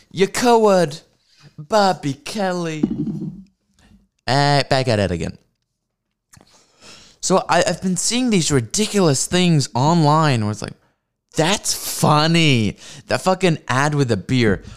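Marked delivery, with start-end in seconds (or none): 0.1-1.0 high, put-on taunting voice; mock taunt. Comedian taunting podcast rival in silly voice. Mock insult repeated in new voice. 1.6-2.8 slow, put-on voice; mock taunt. Calling out rival comedian by name, mocking. 11.4-12.8 very slow, dry; deadpan quote. Retold or imitated. Quoting people online, slow sarcastic deadpan.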